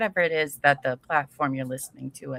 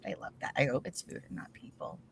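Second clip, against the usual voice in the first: joking whisper; 13 dB quieter than usual. Pretend. Joking aside to a listener.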